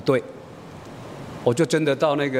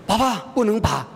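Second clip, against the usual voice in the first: high-pitched mock childish plea; protesting child; 7 semitones higher than usual. Daughter covering mouth before father's pliers, protesting child. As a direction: imitate a small girl backing out. Child suddenly refuses the extraction.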